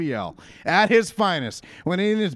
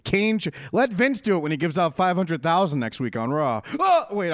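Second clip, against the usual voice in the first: ordinary delivery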